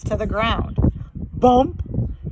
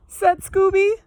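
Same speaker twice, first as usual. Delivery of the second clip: plain reading voice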